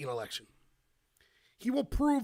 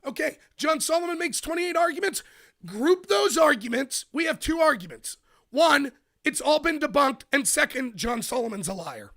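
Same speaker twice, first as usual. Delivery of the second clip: mocking opponents; 6 semitones higher, 5 dB louder than usual. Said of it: "You know what their response to John Solomon's been?".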